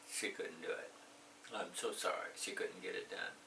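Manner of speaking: soft whispered sadness; hushed, pretend-sorry delivery; mock sad. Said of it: "Very sad".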